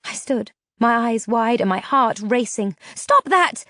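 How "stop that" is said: high, loud scream; frantic shout. A narrator's reading. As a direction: scream it out in panic. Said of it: "I screamed".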